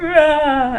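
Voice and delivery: high, loud re-enacted "aah"; grossed-out scream. She drops the bug in a bag, squeamish yell. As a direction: cry out in squeamish alarm. Acts out reaction to bagged bug.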